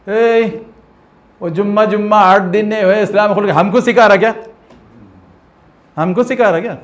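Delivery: loud, high scolding of a newcomer; angry rebuke. Retold or imitated. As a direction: put the upstart in his place. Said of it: "روک ہی دیا". (نئے مسلمان کو غصے سے ڈانٹنا)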